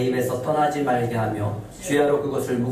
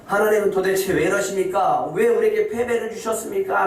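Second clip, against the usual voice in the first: pleading complaint; 8 semitones higher than usual. Acted. Joshua's complaint voiced in sermon.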